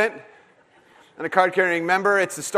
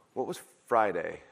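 ordinary talk, nothing marked

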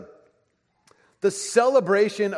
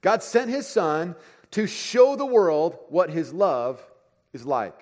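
slow reading; slower than usual. Shown as a demonstration. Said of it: "such a great definition".

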